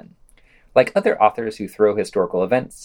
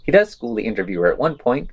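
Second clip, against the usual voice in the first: normal talking voice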